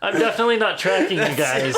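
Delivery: high, loud mock-innocent denial through laughter; laughing denial. Eavesdropper caught at the open door, laughing denial. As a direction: protest innocence while cracking up.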